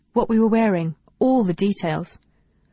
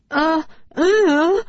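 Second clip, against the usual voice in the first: trembling falsetto; 9 semitones higher, 4 dB louder than usual. Acted. Flustered girl facing her crush.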